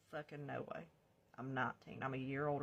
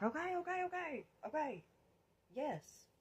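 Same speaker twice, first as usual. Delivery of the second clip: panicked agreeing; 12 semitones higher than usual. Retold or imitated. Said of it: "And I'm like".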